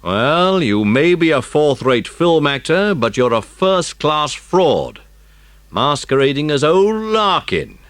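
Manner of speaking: lightly voiced stern police chief, accusing; a firm chief exposing a fraud, slow at first. A narrator's reading.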